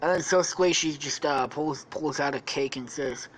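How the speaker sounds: normal talking voice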